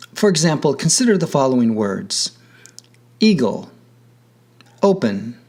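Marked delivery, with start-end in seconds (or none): 3.2-3.7 "eagle" with the weight on its opening syllable; stress on first syllable. Shown as a demonstration. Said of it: "the stress is on the first syllable". 4.8-5.4 "open" stressed on the O, second syllable light; stress on first syllable. Shown as a demonstration. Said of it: "the stress is on the first syllable".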